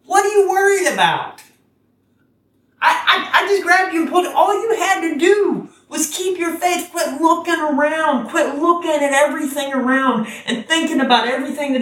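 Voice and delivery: high, loud, exasperated friendly rebuke; a big-brother Jesus, half laughing, half scolding. Retold or imitated. Jesus scolds Peter for doubting, high and loud. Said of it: "He's like".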